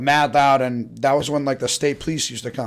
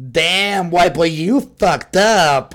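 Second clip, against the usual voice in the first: louder, slow taunting drawl; mocking neighbor; 4 semitones higher, 5 dB louder, much slower than usual. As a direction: tease him as he gets pulled over. Neighbor mocks him.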